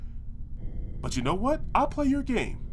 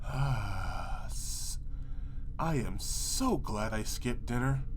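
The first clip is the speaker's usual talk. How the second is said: queasy whimper; 9 semitones lower, 9 dB quieter than usual. Acted. Nauseated, whimpering.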